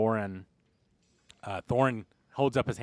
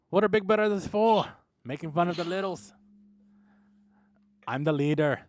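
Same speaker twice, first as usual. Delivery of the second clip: raised, high-pitched bluster; boisterous brotherly taunting; 7 semitones higher, 5 dB louder than usual. Acted. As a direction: play a loud, blustering brother. Brothers bickering, acted by game master.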